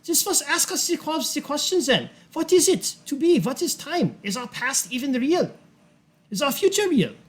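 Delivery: high, theatrical lecturer with rhetorical questions; high, deliberate, swooping old man's oratory; old lecturer in character. Acted. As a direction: pose grand questions in a high-pitched character voice. Game master voices lecturing character.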